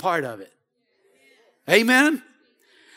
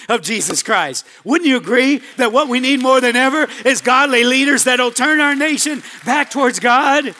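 louder, rising preacher's call; preaching at full force; 4 semitones higher, 4 dB louder than usual. Acted. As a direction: lift the congregation toward the climax.

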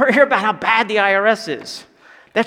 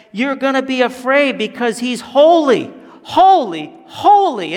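louder and higher with each "holy"; rising emphatic preaching; 4 semitones higher, 6 dB louder than usual. Real, not acted. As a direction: build the triple "holy" to a peak. Preaching climax on holiness.

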